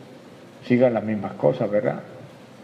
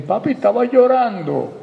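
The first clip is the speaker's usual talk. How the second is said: put-on much higher, louder little child; child's voice; 11 semitones higher, 5 dB louder than usual. Preacher voicing a child asking papi, high childlike voice. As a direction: pipe up like a curious kid.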